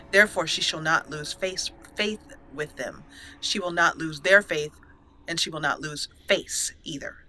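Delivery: ordinary delivery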